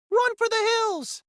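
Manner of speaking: very high, loud panicked cry; screaming. A narrator's reading. Alex's brain warning him to flee, screaming. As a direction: shriek the warning. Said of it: "every rational part of my brain was screaming".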